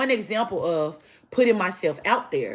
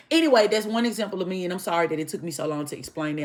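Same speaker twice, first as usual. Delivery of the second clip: usual speaking voice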